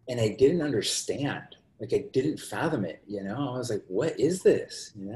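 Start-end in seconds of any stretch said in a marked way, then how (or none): 3.9-4.9 retold bafflement, slow and higher; slow, higher-pitched wondering; bewildered. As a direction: draw out the question in mock disbelief. Retells his own bewildered reaction.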